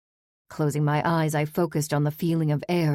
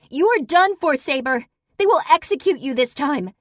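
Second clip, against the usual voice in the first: much higher, loud howling threat; howling shriek; 10 semitones higher, 8 dB louder than usual. Acted. Daughter shrieks threats after the fleeing parolee. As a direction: shriek it down the stairs after her. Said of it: "Her howling shriek chased me".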